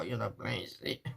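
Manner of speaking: murmured to herself. Real, not acted. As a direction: repeat it under your breath.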